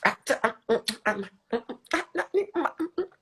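very high, loud, cheerful chirping; squeaky cartoon voice. Streamer in a filter character cheering viewers to dance, squeaky high. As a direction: bounce around like a hyper cartoon mascot.

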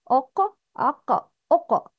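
أق with an echoing bounce on qaf; أق ending in a short echo; qalqalah bounce. Shown as a demonstration. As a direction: let the qaf rebound. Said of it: "Vibration. قلقلة".

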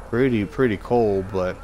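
ordinary talk, nothing marked

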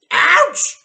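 very high, loud yelp; hen's yelp. A narrator's reading. The hen after the medicine, high yelp. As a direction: squawk in sudden pain.

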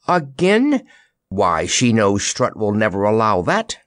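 ordinary delivery

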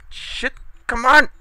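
loud, very high frustrated yell; exasperated shout. Real, not acted. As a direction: scream at the game to cooperate. Raging at game.

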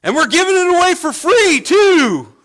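high, very loud yell across a distance; shouting out. Retold or imitated. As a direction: holler it down the whole aisle. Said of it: "and I shout out".

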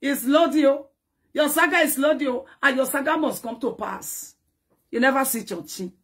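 usual speaking voice

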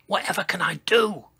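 quick, high fretting; worried thought. A narrator's reading. Dinosaur at a loss for help, quick high worry. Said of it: "thought Sizo".

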